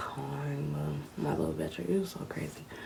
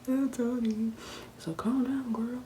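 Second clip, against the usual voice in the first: raised-pitch quote; high quoted exchange; 8 semitones higher, slower than usual. Retold or imitated. Calling to Tony then being calmed, high-pitched. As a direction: call out to Tony. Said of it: "I'm like".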